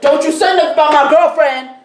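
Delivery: high, loud, fast retort; mock angry yell. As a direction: act out defending your girlfriend, loudly. Retold confrontation over a girlfriend.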